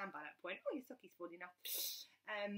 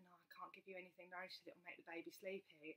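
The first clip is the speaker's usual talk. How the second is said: hushed midwife; 3 semitones lower, 12 dB quieter than usual. Retold or imitated. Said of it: "and she said".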